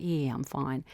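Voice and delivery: very soft, hushed reassurance; old lady softly mouthing back; near-silent reply. Retold or imitated. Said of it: "she's like".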